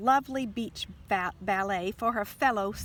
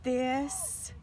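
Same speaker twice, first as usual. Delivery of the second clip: shaky voice; 4 semitones higher than usual. Acted. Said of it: "in a shaky voice".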